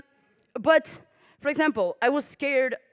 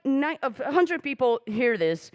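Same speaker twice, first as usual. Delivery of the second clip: usual speaking voice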